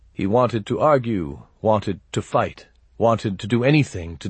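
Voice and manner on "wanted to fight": plain reading voice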